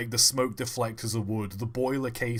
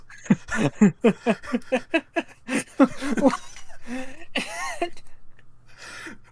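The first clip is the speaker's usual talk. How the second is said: long high-pitched burst of laughter; real squealing laugh; 14 semitones higher, 4 dB louder than usual. Real, not acted. Cracking up at own joke, high squealing laugh.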